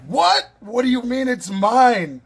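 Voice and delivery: sharp high "What?" then a loud stunned question; retold disbelief. Telling viewers how he reacted to the gift, stunned and loud. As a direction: replay the shock of being handed the knife.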